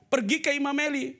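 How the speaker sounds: high, loud, slow calling of a name; God calling. Acted. Preacher acting God calling Samuel in a loud voice. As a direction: call out as God waking the boy.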